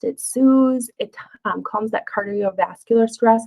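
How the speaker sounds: normal talking voice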